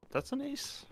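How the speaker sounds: rising, questioning ace call; high, rising, unsure question; surprised question. Real, not acted. Unsure whether it was an ace.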